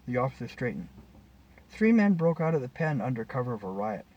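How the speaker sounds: plain reading voice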